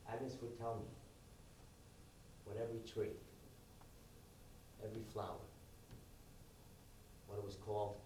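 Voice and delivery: low, soft, unhurried reminiscence with long pauses; hushed, intimate monologue; gentle storytelling. Acted. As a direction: tell it quietly, letting each phrase settle. Tells a story of Agnes.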